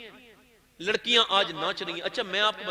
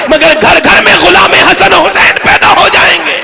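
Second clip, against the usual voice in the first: loud, higher shouting sermon climax, building to a cry; fiery preaching; 6 semitones higher, 25 dB louder than usual. Real, not acted. Preacher exhorting the gathering to love at home, shouting louder and higher. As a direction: preach it hard, rising to a full shout.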